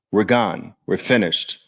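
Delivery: ordinary talk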